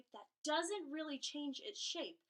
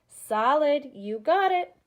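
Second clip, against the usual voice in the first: loud, cheerful praise; enthusiastic praise; 3 semitones higher, 18 dB louder, slower than usual. Acted. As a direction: cheer like a kids show host. Praising pretend answers from kids.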